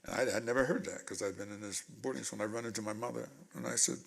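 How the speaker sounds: normal talking voice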